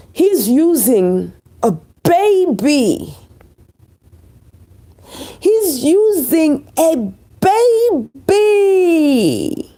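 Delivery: drawn out slowly, voice breaking; slow, pained, almost crying; anguished lament. Real, not acted. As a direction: wail it as if it hurts to say. Disgust at the man using baby.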